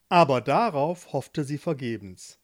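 usual speaking voice

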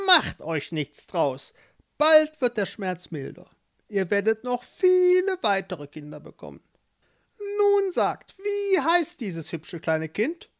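put-on high, sly old witch. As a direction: play the witch, curious and pleased. Witch voiced in story.

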